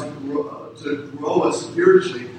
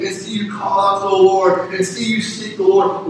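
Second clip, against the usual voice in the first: normal talking voice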